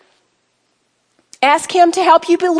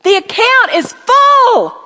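higher, louder and slow, each word weighted; emphatic declaration; 9 semitones higher, 6 dB louder, slower than usual. Shown as a demonstration. Preacher gives the ladies a line to echo, slow and ringing. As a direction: proclaim it so they repeat it. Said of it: "Say that with me".

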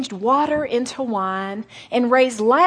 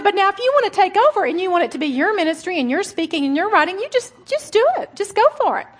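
fast, higher playful dare with a laugh; laughing dare from God; God teasing, laughing; 8 semitones higher, 4 dB louder, faster than usual. Retold or imitated. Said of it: "He said".

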